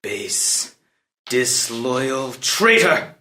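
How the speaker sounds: low, slow contempt, drawn out; seething accusation. Acted. Prince condemns the counselor who refuses him, seething. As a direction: contain the rage as you condemn him.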